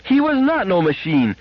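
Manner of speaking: put-on a little girl yelling back at her mother; fierce high-pitched child outburst; fierce child scream. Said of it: "screamed Gloria, fiercely".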